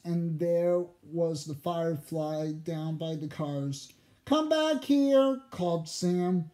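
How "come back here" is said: calling out. A narrator's reading. Sam calls after firefly.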